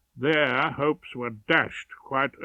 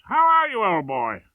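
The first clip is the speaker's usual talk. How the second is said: high, louder hearty banter, slowing at the end; jolly old chum teasing a schoolboy; matiest tones; 7 semitones higher, 7 dB louder than usual. Acted. Barrister jovially teases a marching schoolboy, higher and louder. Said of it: "I called out in my matiest tones".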